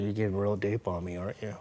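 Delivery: normal talking voice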